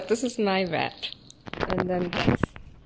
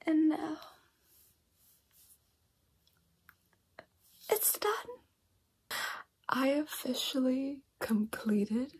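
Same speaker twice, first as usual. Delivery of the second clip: soft, breathy near-whisper; hushed late-night update; 8 dB quieter than usual. Real, not acted.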